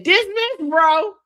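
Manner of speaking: very high, loud retort; mock indignant outburst. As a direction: imitate an outraged squawk at a friend. Repeated acted quote from quarrel.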